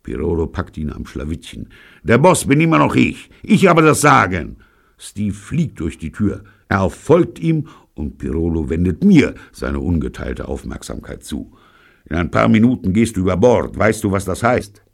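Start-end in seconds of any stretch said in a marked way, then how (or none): 2.0-4.6 loud, high angry shout; thundering show of authority; furious boss. A narrator's reading. Boss grabs rebel by collar. 12.1-14.8 loud, raised threatening voice; forceful, angry warning; menacing threat. A narrator's reading. Boss threatens to throw him overboard.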